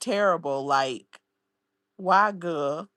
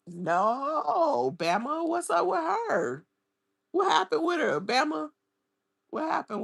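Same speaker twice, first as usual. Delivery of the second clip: much higher, louder, urgent questions; alarmed surprise; 9 semitones higher, 3 dB louder than usual. Real, not acted. Asking Bama about the woman, suddenly alarmed. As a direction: demand to know what happened.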